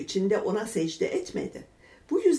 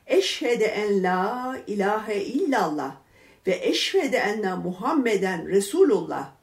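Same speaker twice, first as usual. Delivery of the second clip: eloquent, moving recitation; 4 dB louder than usual. A narrator's reading. Stones in Ebu Cehil's hand bear witness, eloquent and moving. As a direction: recite it solemnly, lingering on the vowels. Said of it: "daha tesirli bir söyleyişle".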